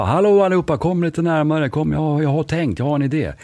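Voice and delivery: rally the whole room from a chair; calling out to the office. Retold or imitated. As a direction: boss shouting to staff. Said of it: "börjar de ropa".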